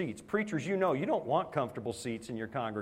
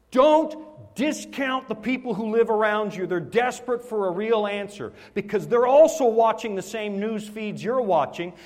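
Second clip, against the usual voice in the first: louder, higher preaching appeal; 7 semitones higher, 7 dB louder than usual. Acted. Preacher urging the congregation, loud and high. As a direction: urge the congregation, full force.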